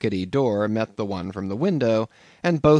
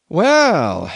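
higher, louder drawn-out landlord; landlord's long breath; 7 semitones higher, 9 dB louder than usual. A narrator's reading. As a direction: take a long breath before speaking. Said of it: "fetching a long breath".

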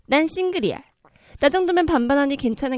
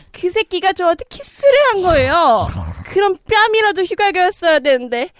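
indignant; 6 semitones higher, 8 dB louder than usual. Acted. Reader indignant at the kiss.

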